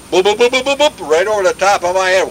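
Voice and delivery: rapid high popping noises; imitated gunfire. Veteran recalling machine gun fire, high popping imitation. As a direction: mimic bullets peppering just overhead. Imitates bullets over his head.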